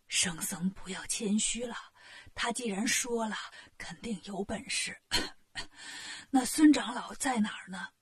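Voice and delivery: soft, whispery, low sick king with a cough; ailing king, hopeful. Acted. Sick king reassures the monk and seeks the healer, feebly hopeful. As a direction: press on weakly, coughing before the question.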